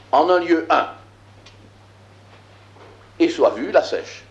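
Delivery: measured, declaiming biblical verse; solemn scripture reading. Acted. Teacher reading Genesis aloud to the class, solemn scripture reading. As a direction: read it like sacred text, weighty.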